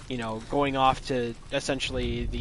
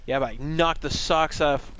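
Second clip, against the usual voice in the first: ordinary talk, nothing marked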